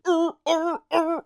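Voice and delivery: high little seal barks; imitated seal bark. Imitated seal after seal joke.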